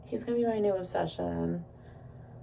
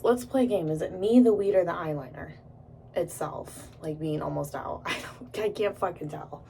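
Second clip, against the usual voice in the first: usual speaking voice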